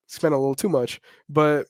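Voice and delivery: ordinary delivery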